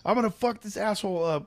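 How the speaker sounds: high-pitched mock rage; retold fighting mad. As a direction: strain to be let loose. Retold struggle as dad holds back.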